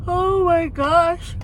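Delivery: long, slow yawn right through the words; drawn-out yawning exclamation. Real, not acted. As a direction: let the yawn swallow the phrase. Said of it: "I can't stop".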